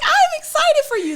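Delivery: beam through the words while laughing; high excited laugh-talk; laughing excitement. Retold or imitated. Retelling her excited reply.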